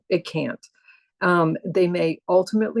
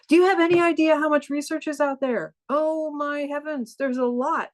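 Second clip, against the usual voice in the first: amazed; 8 semitones higher than usual. Real, not acted. Marvelling at amount of research.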